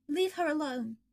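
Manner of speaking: sharp protective snap; a hint of angry brother; snapping. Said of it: "Michael snapped".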